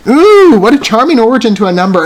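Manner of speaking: high, loud, thrilled ooh; excited ooh at a charming opening; delighted ooh. Real, not acted. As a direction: coo with real delight. Delighted by the next number.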